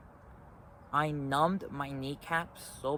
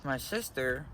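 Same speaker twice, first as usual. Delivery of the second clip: ordinary talk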